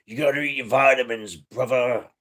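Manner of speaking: quick wrestler catchphrase impression; Hulk Hogan. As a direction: put on a wrestler's voice, drawing out the last word. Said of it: "As Hulk Hogan would say".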